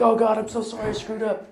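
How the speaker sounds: high-pitched panicky apology; freaking out. Acted. Driver apologising to the foreman, freaking out. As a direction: play a driver pleading in mock panic. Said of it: "you're freaking out".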